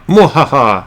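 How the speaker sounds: mad scientist cackle; a hammy evil laugh; evil villain laugh. Pretend. Arch enemy villain joke.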